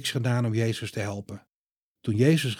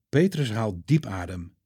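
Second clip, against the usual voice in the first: ordinary delivery, nothing marked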